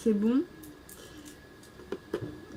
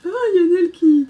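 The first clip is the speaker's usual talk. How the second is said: surprised; 7 semitones higher, 8 dB louder, much faster than usual. Real, not acted. Wing falls off figurine.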